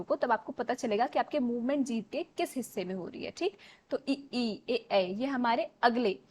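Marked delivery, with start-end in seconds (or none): 3.9-5.1 "इ, ई, ए, ऐ" spaced out and slow, each vowel held apart; slow vowel drill for students; front vowels demo. Shown as a demonstration. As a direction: sound out each front vowel slowly for the class. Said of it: "जीभ के अगले भाग से होते हैं".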